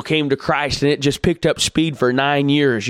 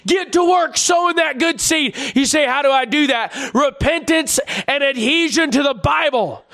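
fiery preaching; 12 semitones higher than usual. Acted. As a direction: cry it out from the pulpit. Preaching at full force.

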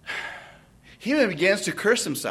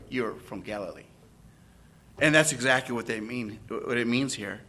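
ordinary talk, nothing marked

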